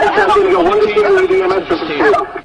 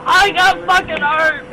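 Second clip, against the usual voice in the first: ordinary delivery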